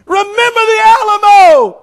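high, loud Texas yell; Texan battle cry. Retold or imitated. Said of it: "He says".

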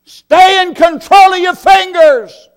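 high, loud shouted command; preaching shout. Acted. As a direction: shout it out to the whole church.

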